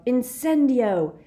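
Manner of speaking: slow, high, loud incantation; yelled spell. A narrator's reading. Death Eater setting the house alight, yelling. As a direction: yell the spell, stretching it out. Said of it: "the huge Death Eater behind him yelled".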